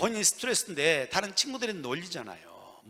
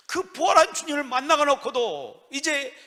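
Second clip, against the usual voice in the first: raised, high-pitched pulpit proclamation; fervent preaching; 6 semitones higher, 7 dB louder than usual. Acted. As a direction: proclaim it with force to the congregation. Preaching at full force. (힘주어 외치는 설교)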